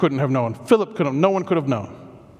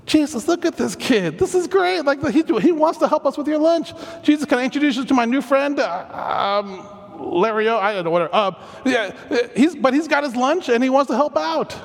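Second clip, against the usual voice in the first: higher, eager, gushing pitch to a friend; excited introduction; 8 semitones higher than usual. Acted. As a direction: gush about your new friend, pitched up. Acts excited Andrew introducing kid.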